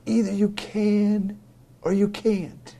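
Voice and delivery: high, slow, insistent retort; lightly voiced mom, high and drawn out; a touch of the pushy mom; mock insistent mom.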